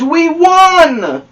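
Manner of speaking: loud, high cheer; excited shout. Acted. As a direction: cheer the win aloud. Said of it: "shouted the boys".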